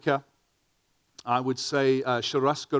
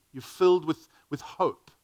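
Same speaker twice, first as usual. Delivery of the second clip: usual speaking voice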